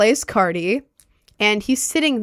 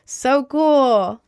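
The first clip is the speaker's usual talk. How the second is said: fake enthusiasm; 3 semitones higher, slower than usual. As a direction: play along, humouring him out of fear. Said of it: "So I was kind of being like".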